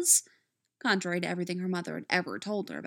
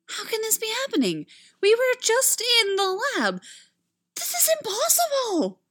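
panicked; 13 semitones higher, 5 dB louder than usual. A narrator's reading. Amy panicking, flailing.

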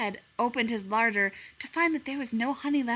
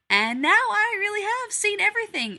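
much higher, loud disbelief; exasperated outburst; 11 semitones higher, 9 dB louder than usual. A narrator's reading. Strange animal exasperated by Pooh, a high loud outburst. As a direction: throw up your hands at the sight.

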